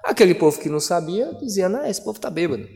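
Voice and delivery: ordinary talk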